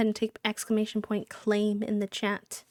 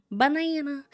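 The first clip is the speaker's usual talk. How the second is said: playful banana; 6 semitones higher, 4 dB louder than usual. Pretend. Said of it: "I don't know why I said it like that".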